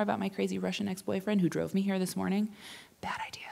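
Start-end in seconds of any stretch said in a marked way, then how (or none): 3.0-3.4 whispered aside. Real, not acted. Aside about ex driving her.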